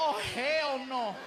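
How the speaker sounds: slow, drawn-out mock horror; appalled refusal. Rider seeing level six walk towards the elevator, appalled. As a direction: stretch out the refusal.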